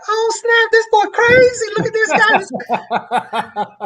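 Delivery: hammed-up high voice of an amused cashier; retelling a cashier laughing at his knife; imitating the cashier lady.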